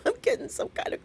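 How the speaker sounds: high, quick words through laughter; laughing. Real, not acted. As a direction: talk while cracking up, voice squeezed high. Laughing about weekly crazy gifts.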